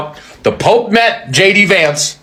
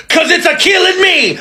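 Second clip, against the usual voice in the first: mock Italian Pope; 10 semitones higher than usual.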